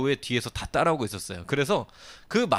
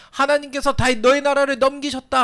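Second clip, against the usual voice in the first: cursing shout; 13 semitones higher, 7 dB louder than usual. Retold or imitated. Shimei shouting curses at David, high and loud. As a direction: hurl the curse in God's name. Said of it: "하면서 막 하나님의 이름을 지금 불러요".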